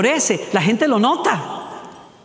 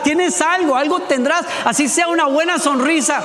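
fervent preaching; 8 semitones higher than usual. Real, not acted. Preacher urging the congregation.

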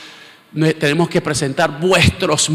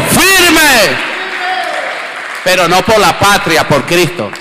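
louder, climbing into a congregation call; preaching at full force; 2 semitones higher, 10 dB louder than usual. Acted. Preacher calling his congregation to stand firm, loud. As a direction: rouse the church to stand firm.

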